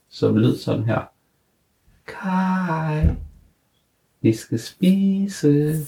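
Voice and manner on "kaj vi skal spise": very high, slow, dainty little voice; tiny delicate voice calling Kaj; fine little voice. Acted. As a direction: call him sweetly to dinner. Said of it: "en lille stemme, som var meget fin".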